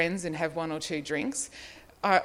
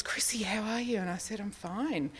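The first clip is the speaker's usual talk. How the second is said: hushed; 4 semitones higher, 5 dB quieter than usual. Retold or imitated. Retelling quiet exchange after landing.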